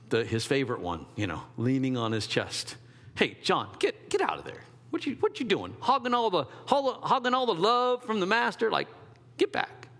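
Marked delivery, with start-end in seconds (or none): 3.1-9.9 high, teasing jealous shoo; hammed-up elbowing a rival for love; mock jealous disciple. Preacher plays disciple shooing John from the master, mock jealous.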